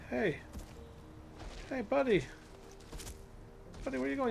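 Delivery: high sing-song voice for a pet; pet talk. Real, not acted. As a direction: coax the animal like a puppy. Calling to a pet creature.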